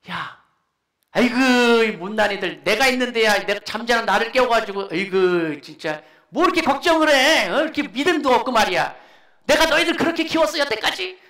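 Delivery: loud exasperated telling-off, rising in pitch; angry scolding. Acted. Preacher acts Jesus berating the disciples, angry complaining rebuke. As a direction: scold them as if fed up, building to a shout. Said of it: "화내시면서 이렇게 꾸지람을 하고".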